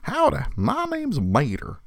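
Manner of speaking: high, slow, drawling Southern tow-truck voice; Mater voice. Shown as a demonstration. Showing off the Mater voice for posterity. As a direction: ham up a Larry the Cable Guy Mater. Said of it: "I'll do it".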